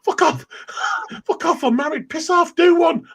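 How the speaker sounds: high, hammed-up telling-off; high-pitched sweary protest, half laughing; retold panicked protest. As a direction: fend someone off in comic alarm. Retold protest at being picked up.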